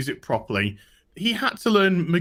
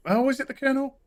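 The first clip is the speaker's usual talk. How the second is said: higher and fast, through a real laugh; laughing guess; 9 semitones higher, much faster than usual. Real, not acted. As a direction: blurt the guess while still laughing. Laughing guess at character name.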